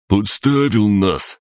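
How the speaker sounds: high, drawn-out accusation; slow, acted reproach; accusing. A narrator's reading. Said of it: "обвинил зелёный".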